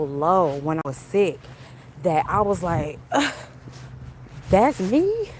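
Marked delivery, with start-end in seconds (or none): 3.1-5.3 shocked. Retold or imitated. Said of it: "I was so shocked".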